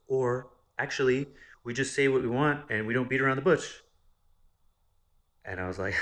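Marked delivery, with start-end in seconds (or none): 0.1-3.8 loud, higher brash know-it-all voice; mocking blunt cousin. Cousin interrupting him to lecture on bluntness, loud mock. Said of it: "homegirl interrupts me".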